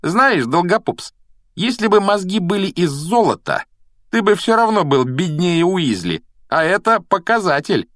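high-pitched, jeering insult; relentless taunting. A narrator's reading. Malfoy keeps mocking Neville and poor Weasley, taunting. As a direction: keep needling, rubbing it in. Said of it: "не успокаивался Малфой".

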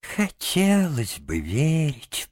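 slow, high, faint deathbed tones; dying voice. A narrator's reading. Sick Toad doubting Rat, dying voice. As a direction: drag it out as if fading away. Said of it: "прошептал Жабе совсем уж умирающим голосом".

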